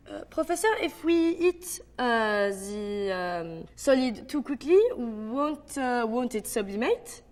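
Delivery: French accent full of "uh" pauses. Shown as a demonstration. Student asking the professor, hesitant French accent. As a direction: stretch filler sounds between phrases, French accent. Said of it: "the French have a sound for their pauses".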